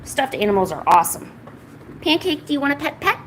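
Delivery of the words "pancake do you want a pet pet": high, lilting question to a pet. Real, not acted. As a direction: coo it upward, coaxingly. Baby-talking to a pet.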